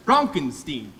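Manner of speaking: Frankenstein said Fronk-en-steen, open o and long ee; pronounced name. Acted. Insisting to the messenger, Frankenstein as Fronkensteen. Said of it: "it's pronounced".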